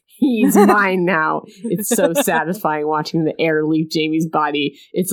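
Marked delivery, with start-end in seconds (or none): 0.1-1.4 slow possessive claim. Retold or imitated. Possessive retold line.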